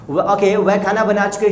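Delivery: usual speaking voice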